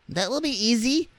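very high, piping doll voice; squeaky Dutch doll. A narrator's reading. Dutch doll answering Raggedy Ann, squeaky doll. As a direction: say it in a little falsetto.

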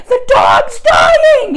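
high, loud, drawn-out mock wail; freaking out. Kid tells great-grandpa about the dog, hammed-up panicked wail. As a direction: imitate a bawling child in panic. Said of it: "you know, freaking out".

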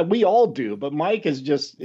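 ordinary talk

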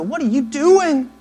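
high-pitched, incredulous scolding; mock rebuke. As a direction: call someone out, pitch jumping up. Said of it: "sometimes it's a soft whisper. Sometimes it's a".

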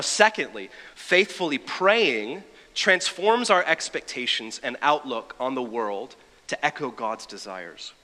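usual speaking voice

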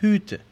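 Hüte with rounded ü, lips pushed forward, said slowly; slow teaching demo of Hüte; German ü sound. Shown as a demonstration. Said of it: "El plural es Hüte".

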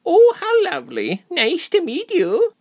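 high-pitched sweet hostess, drawing out the ending; sweet smile. A narrator's reading. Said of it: "she smiled sweetly".

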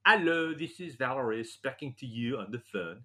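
put-on stiff, formal phone greeting in a light French accent; a hint of his French wife answering politely; mock French wife. Husband imitating his wife's formal phone call in a light French accent. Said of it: "She says".

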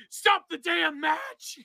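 high, slow shouted announcer plea; J R impression. For the co-host, a slow shouted announcer plea. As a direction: beg like a horrified commentator. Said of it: "I did the J R".

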